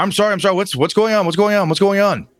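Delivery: high, rapid-fire apology; panicky, repeated questioning; flustered innkeeper. Acted. Innkeeper rushing out to an angry customer, flustered.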